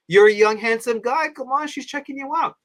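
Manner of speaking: high-pitched coaxing from a pal; persuading. Retold or imitated. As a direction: egg a friend on, pitched well up. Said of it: "like, persuading me".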